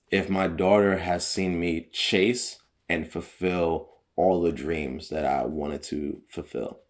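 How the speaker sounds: ordinary talk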